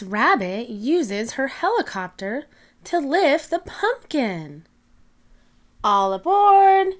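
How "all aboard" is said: loud, high all aboard call; cheerful call. Acted. Miss Rabbit calling to passengers, loud and cheerful. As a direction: call out like a pilot boarding passengers. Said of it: "she says".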